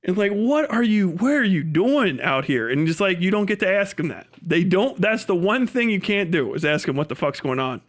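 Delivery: usual speaking voice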